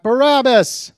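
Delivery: loud, high shout of a crowd; crowd shouting back. A narrator's reading. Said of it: "The crowd shouted back".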